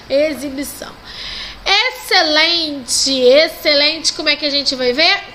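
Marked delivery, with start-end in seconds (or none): 1.7-4.1 excelente, xc als s, traag; slow model word. Shown as a demonstration. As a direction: excelente drawn out. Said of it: "com o som de S".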